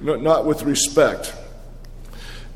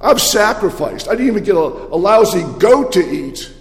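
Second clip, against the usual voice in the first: higher, indignant protest; resentful older son; 6 semitones higher than usual. Acted. Older son complaining to his father about his brother, indignant and higher. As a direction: list every grievance with rising bitterness. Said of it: "And the son said".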